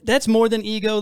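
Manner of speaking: ordinary talk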